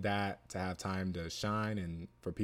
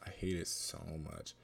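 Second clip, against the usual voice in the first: ordinary talk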